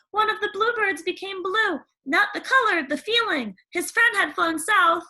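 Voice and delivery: put-on high, bright chatterbox girl; gushing young girl, rising as she goes; eager Cindy telling about animals. Cindy voiced in story.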